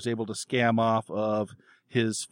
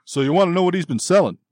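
loud, raised, put-on manly voice; masculine fairy voice; 7 semitones higher, 7 dB louder, faster than usual. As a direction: talk business loudly as a manly little fairy. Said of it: "a more masculine voice comes across".

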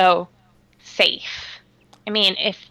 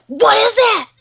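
high, loud mimicked kid shrieks; hammed-up kid panic, pitched way up; panicked kids; 12 semitones higher, 5 dB louder than usual. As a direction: imitate children yelling in alarm. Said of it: "you hear kids are like".

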